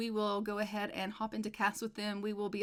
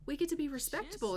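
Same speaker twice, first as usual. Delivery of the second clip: quick and higher, bubbling over; excited wife; 6 semitones higher, faster than usual. Retold or imitated. Wife rejoices to husband about new rank, quick excited gush. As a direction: gush it out, thrilled. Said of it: "like, so excited".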